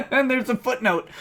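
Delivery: high, talking through a real laugh. Real, not acted. Reader delighted to find a footnote, laughing. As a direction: barely get the words out laughing. Amused by the filing.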